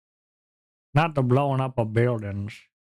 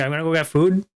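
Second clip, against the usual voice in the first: usual speaking voice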